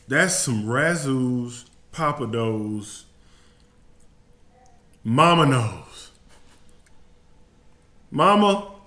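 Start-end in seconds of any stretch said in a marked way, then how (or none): 5.0-8.7 slow, louder triumphant call; shouting his joy to mama; loud, emotional praise; proud call out. Real, not acted.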